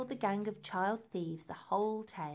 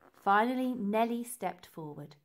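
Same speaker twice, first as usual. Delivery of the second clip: usual speaking voice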